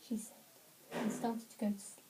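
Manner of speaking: plain reading voice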